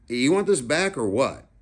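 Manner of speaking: put-on a big kid calling over to the little one; narrator's own line.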